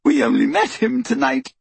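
high-pitched tearful plea; sobbing. A narrator's reading. Tortured girl protesting to her interrogator, sobbing. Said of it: "Hermione sobbed".

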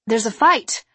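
urgent report to a guard; urgent alert. Acted. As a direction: call the guard's attention to trouble.

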